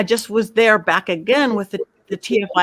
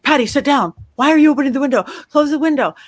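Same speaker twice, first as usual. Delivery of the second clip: higher, mock-stern yell at Patty; retold yelling; 5 semitones higher, faster than usual. Friend yelling at Patty to close the window, mock yell. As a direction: bark it like an alarmed friend, but retold. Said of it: "Zach is yelling at me".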